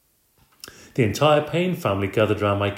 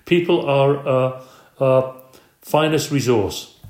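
nervous stammer; 4 semitones higher, 5 dB louder, slower than usual. Acted. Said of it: "running a handkerchief across his".

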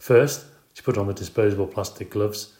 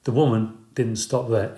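usual speaking voice

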